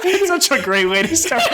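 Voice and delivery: talking through real laughter, pitched high; high, laughing voice. Real, not acted. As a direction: crack up while getting the words out. Cracking up at the opener.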